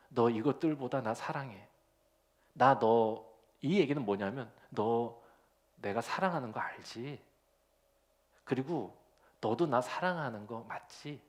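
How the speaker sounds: softly asking, lightly tender; gentle reassurance. Retold or imitated. Jesus gently asking Peter, mildly tender. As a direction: check his love with quiet tenderness. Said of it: "이렇게 확인하시는 거예요".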